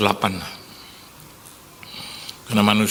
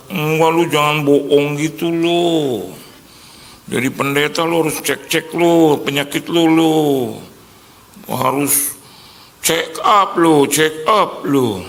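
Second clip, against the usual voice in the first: higher, louder engkoh voice nagging a friend; Chinese-Indonesian uncle; 5 semitones higher, 4 dB louder, slower than usual. Retold or imitated. Friend's uncle warns him to get checkups, in a Chinese-Indonesian accent. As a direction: lecture him like a fussy old uncle. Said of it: "Si engkau bilang".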